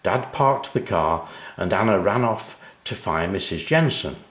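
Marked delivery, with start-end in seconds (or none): none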